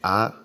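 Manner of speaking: "A" said the French way, open "ah", loud. Shown as a demonstration. Teacher contrasts French A before English. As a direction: model the French "A". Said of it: "A en français".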